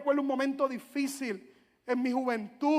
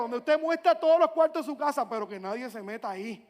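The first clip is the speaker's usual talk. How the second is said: normal talking voice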